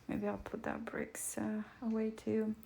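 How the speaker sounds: hushed whisper while tidying up. Real, not acted. Whispering to herself so the new kitten isn't startled. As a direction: keep it breathy and secret, almost no voice.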